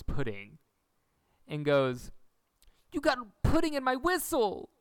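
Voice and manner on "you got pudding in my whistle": high, loud indignant cry; outraged yell. Retold or imitated. Quoting Cody, outraged.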